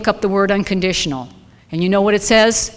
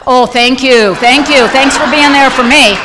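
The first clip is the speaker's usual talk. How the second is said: sarcastic thanks; 4 semitones higher, 8 dB louder than usual. Retold or imitated. Sarcastic thanks after sponsor hangs.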